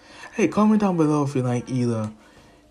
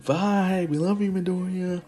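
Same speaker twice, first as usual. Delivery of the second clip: high fond goodbye; 7 semitones higher than usual. Retold or imitated. Mother's goodbye.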